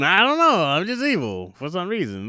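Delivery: a dopey villain voice, high then trailing off; clueless villain. Acted. Villain answering the hero, a hint of dopey shrug.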